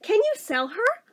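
high-pitched mock child's question; bargaining kid. Child asking mom to get rid of sister, high kid voice.

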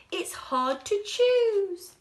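high, loud, slow voice; delighted customer. Acted. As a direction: fuss happily over choice.

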